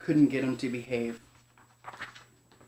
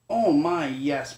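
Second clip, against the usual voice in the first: ordinary delivery, nothing marked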